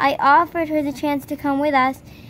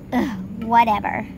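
ordinary delivery

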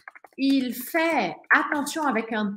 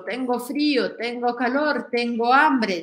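ordinary talk, nothing marked

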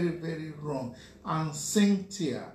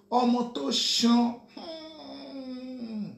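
louder, slow mock catcall of Ọmọ tó ṣan; mock catcaller; 7 semitones higher, 4 dB louder, much slower than usual. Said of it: "by saying".

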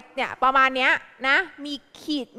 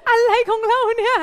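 surprised laugh; 8 semitones higher, 5 dB louder than usual. Real, not acted. Teacher reacts to pupils' answer.

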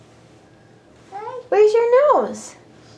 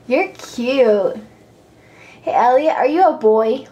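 normal talking voice

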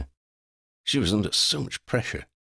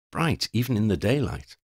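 ordinary delivery, nothing marked

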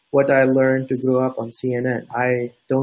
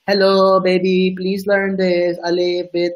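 put-on baby teaching voice; 5 semitones higher than usual. Mock parent teaching a baby the alphabet, high singsong.

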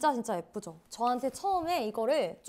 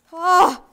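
sudden cry; 7 semitones higher, 17 dB louder than usual. Real, not acted. Card slides in forcefully.

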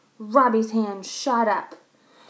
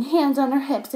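ordinary delivery, nothing marked

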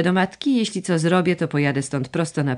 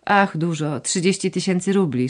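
ordinary delivery, nothing marked

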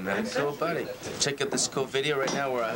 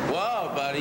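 usual speaking voice

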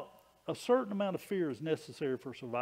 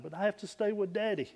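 higher, quicker lightly voiced mom; mother's reply; 6 semitones higher, faster than usual. Mother answers her scared son in the story, lightly imitated reply. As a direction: gently imitate a mother explaining herself.

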